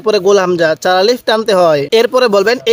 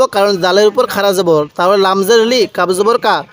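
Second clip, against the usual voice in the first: usual speaking voice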